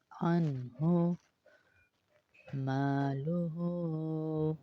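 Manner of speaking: انہو with the vowel drawn out slowly; stretched vowel. Shown as a demonstration. Teacher recites slowly for students. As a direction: draw out انہو gently. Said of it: "نا کو اس لیے کھینچا ہے".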